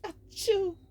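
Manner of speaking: loud high achoo; big sneeze. Acted. Elsa sneezes.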